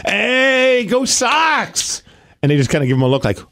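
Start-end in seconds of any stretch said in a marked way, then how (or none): 0.1-2.0 excited cheer. Retold or imitated. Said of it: "He'll be like".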